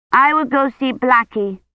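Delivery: put-on little girl voice. A narrator's reading. Agnes announces.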